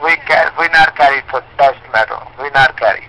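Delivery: ordinary talk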